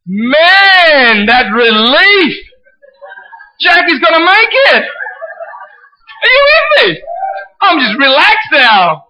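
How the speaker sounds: loud, high, hammed-up elation; exuberant relief. Husband learning the TV hero survives, acted shouted relief. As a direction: shout it out, stretching the words in mock jubilation. Acting out relief after wife's reassurance.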